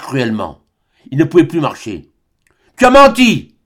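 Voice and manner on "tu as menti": high, loud accusing shout; angry cry. A narrator's reading. Albine shouts at Serge, accusing him, loud and high. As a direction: hurl it at him, much higher and louder. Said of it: "cria Albine".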